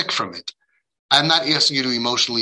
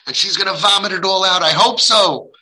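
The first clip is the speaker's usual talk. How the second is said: ordinary talk, nothing marked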